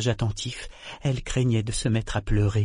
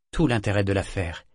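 ordinary delivery, nothing marked

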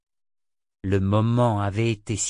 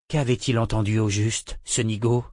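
ordinary delivery

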